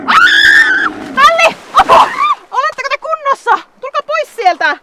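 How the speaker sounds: high, loud cries of alarm; alarmed shout. Acted. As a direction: yell out to them in the river. Camel plunges into river.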